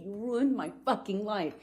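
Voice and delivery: usual speaking voice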